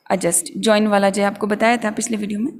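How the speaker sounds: normal talking voice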